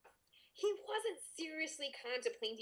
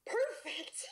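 excited; 8 semitones higher than usual. Real, not acted. Loud whispered exclamation.

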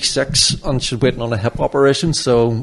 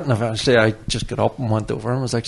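ordinary talk

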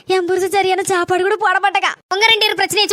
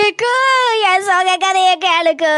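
mock sorrowful; slower than usual. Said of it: "உனக்கு என்னடி சோகம்?".

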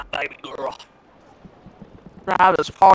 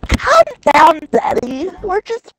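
put-on teasing baby voice; 10 semitones higher than usual. Teasing a friend mid-race in high baby talk. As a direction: coo at him like a toddler.

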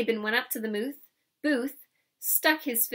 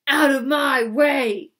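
slow roaring bully, much louder; bellowing brute; 3 semitones higher, 11 dB louder, slower than usual. A narrator's reading. Drunk Laban roaring at the crowd, slow bellow. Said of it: "he cried".

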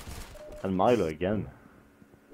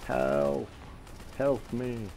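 calling for help; 6 semitones higher, much slower than usual. Real, not acted. Calling teammates for help in game.

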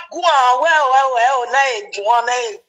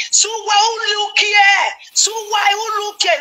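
mock yell; 7 semitones higher than usual. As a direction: shout the name across the room. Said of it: "Mama Nora say".

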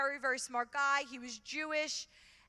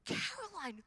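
much higher, slow, scolding; appalled friend's whisper-shout; scandalized friend; 10 semitones higher than usual. Retold or imitated. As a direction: scold her in disbelief.

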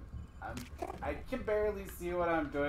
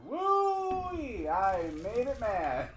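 laughing; 8 semitones higher, 10 dB louder, much slower than usual. Real, not acted. Laughing while fleeing spider.

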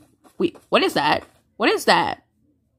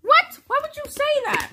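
much higher, incredulous question; shocked protest; 12 semitones higher than usual. Real, not acted. Boy objecting to what his co-host told viewers, shocked protest. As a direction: object in high-pitched disbelief.

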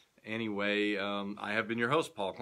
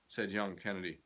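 usual speaking voice

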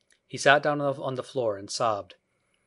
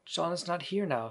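mildly gasped, higher reply; a touch breath-caught, pitched up; 7 semitones higher, 3 dB quieter than usual. A narrator's reading. Wendy answers Peter about John, lightly gasped. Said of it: "she gasped".

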